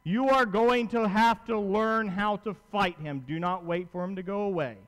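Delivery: ordinary talk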